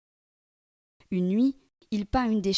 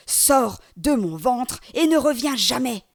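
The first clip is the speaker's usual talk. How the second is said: loud, slow monster order; slow booming demand to leave; angry demon; 4 semitones higher, 8 dB louder, slower than usual. Acted. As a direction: growl the command.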